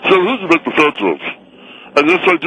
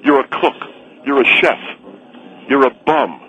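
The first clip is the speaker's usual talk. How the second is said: ordinary talk, nothing marked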